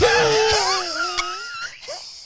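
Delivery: high wheezing smoker laugh; smoker's laugh. Shown as a demonstration. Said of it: "it sounds like I be on Newports".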